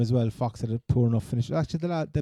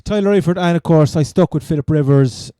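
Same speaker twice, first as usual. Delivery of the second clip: loud, exasperated burst; exasperated outburst; 5 semitones higher, 10 dB louder than usual. Real, not acted. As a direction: blurt the name out in frustration. Griping about a fantasy loss.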